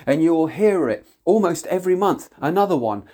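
usual speaking voice

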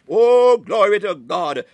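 loud, drawn-out cry of praise; fervent preacher's shout; exultant praise. Real, not acted. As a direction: cry it out slowly to the congregation.

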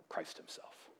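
hushed, breathless whisper. Acted. Preacher revealing what remains after the vision, whispered. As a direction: let it fall to a whisper.

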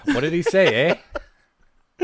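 high, loud put-on Canadian accent; joking Canadian imitation edged with laughter; mock Canadian. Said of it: "the Amazing French Canadians are coming".

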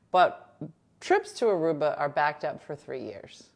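mock breaking news read; news anchor voice.

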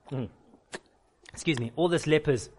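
normal talking voice

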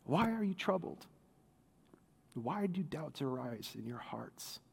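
soft, low, tender asking; hushed question. Acted. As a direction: ask it gently, almost under the breath. Repeat, much softer, lower.

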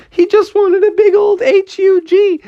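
very high excited squeal; delighted explaining. Acted. Froggy explaining to his toys what Cobra wanted, excited. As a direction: squeal the good news to everyone.

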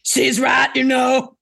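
loud, put-on voice for Darren; half-shouted character line; loud interjection. Darren breaks into her thoughts.

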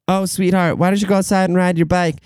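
high, sugary mom voice; sweet mom. Retold or imitated. Mom urging her kid to ride the bike, high and sweet. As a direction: coax like a doting mother.

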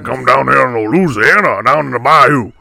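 very loud, hollering Cajun caricature; Coach O. Pretend. Co-host parodies a Louisiana coach, hollered Cajun impression. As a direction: belt it out as a bayou coach. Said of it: "trying to play for Coach O".

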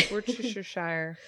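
Worcestershire softly, as wor-tish-er-shire; defeated muddled attempt. Shown as a demonstration. Friend admits the word is beyond her, softly fumbles Worcestershire. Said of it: "that word is beyond me".

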